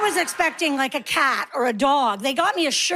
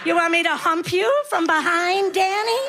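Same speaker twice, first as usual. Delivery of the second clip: higher and higher, faster, cheeky; pretend deaf lady, rising; 7 semitones higher than usual. Comedian mocking hecklers, high playful voice. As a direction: push the mishearing into squeaky glee. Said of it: "I'll do what I do".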